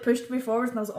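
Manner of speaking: ordinary talk, nothing marked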